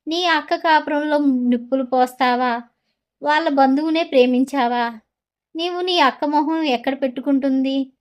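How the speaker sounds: ordinary delivery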